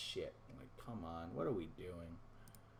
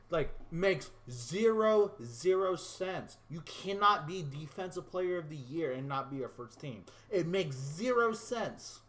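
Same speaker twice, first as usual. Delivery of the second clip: exasperated rant; 5 semitones higher, 4 dB louder than usual. Real, not acted. Gamer ranting at the game's awards, loud and exasperated. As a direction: hammer each word in outrage.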